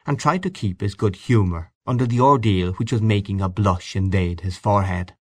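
usual speaking voice

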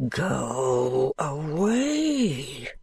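very slow, hollow and grave; solemn ghostly command, stretched out. Acted. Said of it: "repeats more solemnly".

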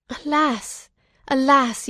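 high, anguished sigh of a word; tearful lament. A narrator's reading. Weeping son answering his father about his murdered friend, tearful. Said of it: "I shed tears".

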